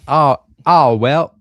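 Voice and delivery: excited. Real, not acted. Excited cheering over the name.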